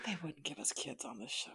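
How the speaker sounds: hushed, breathless whisper of dread; a mock-ominous whisper to his co-host. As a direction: whisper it like a dark secret. Whispered repeat as dark joke.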